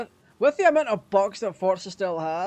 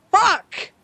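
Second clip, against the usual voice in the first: frustrated yell; 11 semitones higher, 7 dB louder than usual. Real, not acted. Sighs then curses loss.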